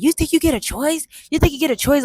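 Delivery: high, sharp interrogating drill voice; mock grilling officer. Superior officer grilling a recruit, mock harsh. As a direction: imitate a superior grilling her. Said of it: "she started grilling my ass".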